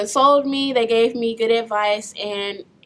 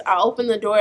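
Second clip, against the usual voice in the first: usual speaking voice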